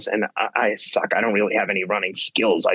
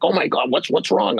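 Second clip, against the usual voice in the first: higher, imitating panicked race staff; mock panic; 8 semitones higher than usual. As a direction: play the alarmed helpers, mildly hammed. Retelling staff panicking at blood.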